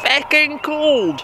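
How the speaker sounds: slow, high drawn-out exclamation; exclaiming at the cold. Real, not acted. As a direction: stretch out feckin' up high. Complains about cold.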